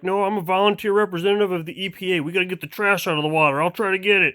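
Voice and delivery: fast high logger voice. Acted. Second logger answers.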